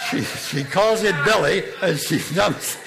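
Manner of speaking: can barely get it out for laughing; laughing while talking. Real, not acted. Still laughing telling the punchline.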